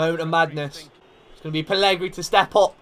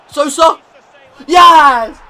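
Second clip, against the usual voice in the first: huge high yell as the goal goes in; excited shout; 12 semitones higher, 16 dB louder, much slower than usual. Real, not acted. Manager watching his striker score, shouting with joy. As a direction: scream the scorer's name and yes, overjoyed. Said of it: "I got a bit excited".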